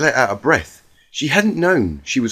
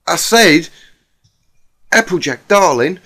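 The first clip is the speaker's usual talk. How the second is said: Rarity voice; 4 semitones higher, 6 dB louder than usual. Acted. Rarity's voice, higher.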